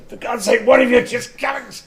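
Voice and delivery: high, loud exasperated yell; exasperated shout. Retold or imitated. The Doctor yells at squabbling companions, exasperated shout. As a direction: bark it out as the fed-up Doctor. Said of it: "and Doctor said".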